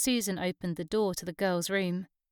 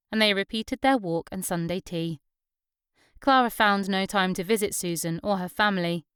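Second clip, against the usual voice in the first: ordinary delivery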